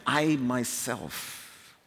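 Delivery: slow, each word weighted: I myself; stress on I myself. Shown as a demonstration. Preacher reading God's own words, slow emphatic stress. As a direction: lean hard on "I myself". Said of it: "Again, the emphatic".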